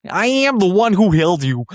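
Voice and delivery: mock Muppet-style delivery; Kermit-like American accent. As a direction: play a singer sounding like Kermit. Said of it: "very Kermit the Frog".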